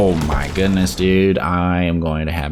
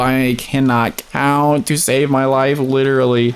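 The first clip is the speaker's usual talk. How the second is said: exasperated high whine; 10 semitones higher, 4 dB louder than usual. Real, not acted. Dying on one health.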